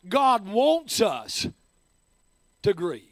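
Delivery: slow, with a pause before "to grieve"; slow emphatic rephrase. Shown as a demonstration. Pastor rephrasing for the congregation, slow and weighty. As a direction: lean on "wants", then pause and drop for "to grieve". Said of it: "Can I just say it this way?".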